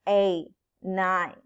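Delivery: normal talking voice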